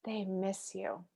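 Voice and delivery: slow, soft reassurance; tender reveal. Retold or imitated. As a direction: break the news gently, almost hushed. Teacher's gentle reply after the tirade.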